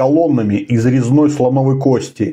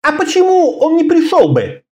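much higher puzzled question; surprised. Acted. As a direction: ask it in astonishment. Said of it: "удивлённо посмотрела".

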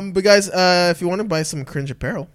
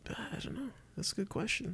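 whispered; 4 semitones higher, 17 dB quieter than usual. Real, not acted. Unsure joking reply.

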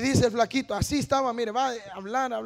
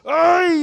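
high, loud "ay" wail; crying wail; 8 semitones higher, 10 dB louder than usual. Retold or imitated. Acting out his weeping at the sermon, loud wail. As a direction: cry out in re-enacted anguish. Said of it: "yo lloraba".

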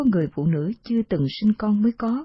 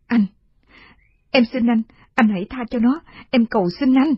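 desperate begging; 4 semitones higher than usual. Acted. Mother begs mercy.

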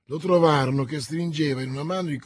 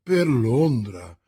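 slow, louder incredulous "Per Londra?", stretching "Per"; surprised exclamation; 7 dB louder, slower than usual. A narrator's reading. Porthos echoes the destination to his friends, astonished. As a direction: throw back "Per Londra?" in disbelief, slow and loud. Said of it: "esclamò Porthos".

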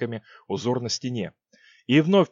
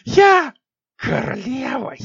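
mocking witch sneer; smirking; 14 semitones higher, 7 dB louder, much slower than usual. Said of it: "ухмыльнулась Сикс".

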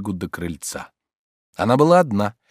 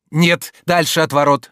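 raised, loud, brisk reply; lively; 7 semitones higher, 7 dB louder than usual. A narrator's reading. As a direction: put in with sudden liveliness. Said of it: "живо сказал Обломов". (живо, громко)

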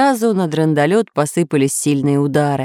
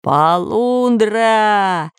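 long drawn-out, raised warning call; pirate yell; 5 semitones higher, much slower than usual. A narrator's reading. Pirate Bulbul warns the crew of attack, drawn-out yell. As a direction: stretch the alarm out like a sailor's holler. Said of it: "закричал пират Бульбуль".

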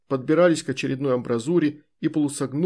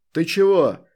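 loud angry snap at a soldier; angry yell; 3 semitones higher, 6 dB louder than usual. Acted. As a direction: bark it at him. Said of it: "заорал командир".

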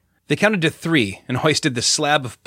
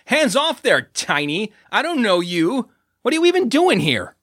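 alarmed shouting; 10 semitones higher than usual. A narrator's reading. Jason yelling at the big guy who grabs him, high panicked shout. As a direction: yell it up high as he's grabbed.

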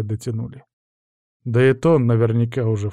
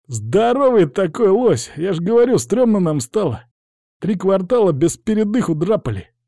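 put-on high, whiny young hooligan; cornered lad, pitched up; questioned lad; 8 semitones higher than usual. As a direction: plead ignorance in a high boyish voice. Boy describes the attacker.

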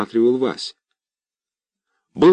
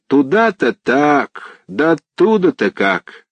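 slow ominous warning; 5 semitones higher, slower than usual. Acted. Old man's slow warning.

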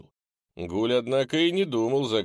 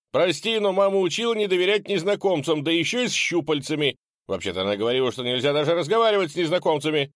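high, fast cheeky quip; cheeky taunt; 8 semitones higher, 4 dB louder, much faster than usual. Acted. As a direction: tease him as you dodge. Hero taunts while dodging.

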